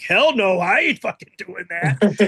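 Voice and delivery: a hammed-up flat refusal; mock refusal. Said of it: "Manawa's like".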